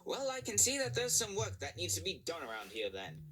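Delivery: slightly high, bossy controller; a hint of a brisk new boss; lightly accented controller. A narrator's reading. Controller voice held.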